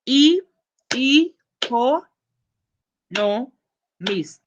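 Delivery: "E, co, no, mist" in separate beats, starting high; syllable by syllable. Shown as a demonstration. Teacher counts out four syllables, spaced and punched. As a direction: break "economist" into spaced syllables. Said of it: "four syllables in economist".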